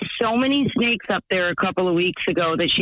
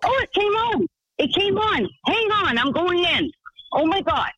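much higher-pitched, thrilled outburst; excited squeal; 10 semitones higher than usual. Real, not acted. Caller under the kids' splash fountain as it starts, excited squealing. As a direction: squeal it as cold water suddenly hits.

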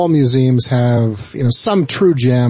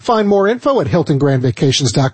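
polished sponsor read, lifted at the start; radio ad read; 8 semitones higher than usual. Acted. Host presents the vacation sponsor to listeners, radio ad read. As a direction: sell it like a radio host reading copy.

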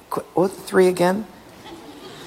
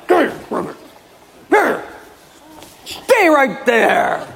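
grumbling husband; 9 semitones higher than usual. Acted. Husband grudgingly handles rubbish.

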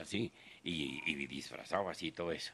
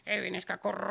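squeaky joking falsetto; high mock voice; 3 dB louder than usual.

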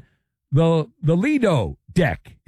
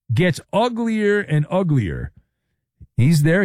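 ordinary delivery

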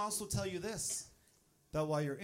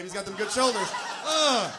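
strained reaction; 4 semitones higher, 9 dB louder than usual. Acted. Dad reacting during imagined birth.

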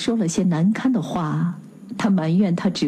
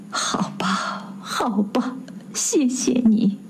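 much softer, tearful, slow thanks; heartbroken resignation; 2 semitones higher, 4 dB quieter, slower than usual. Acted. Husband thanks Christophe through tears, very softly. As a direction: give up, holding back tears. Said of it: "伯罗姆说".

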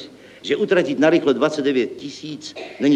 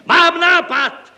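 slow, very high, loud cry; joyful shout; 9 dB louder, much slower than usual. A narrator's reading. Deputy suddenly has an idea, joyful shout. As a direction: shout it out in jubilation. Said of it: "vykřikl radostně zástupce".

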